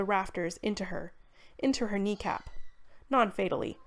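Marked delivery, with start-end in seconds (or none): none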